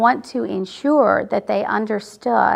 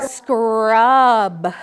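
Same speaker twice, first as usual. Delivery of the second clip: louder, lower blended word; 5 dB louder than usual. Shown as a demonstration. Teacher models blending "Scrub" for the class. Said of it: "blend it together to make the word".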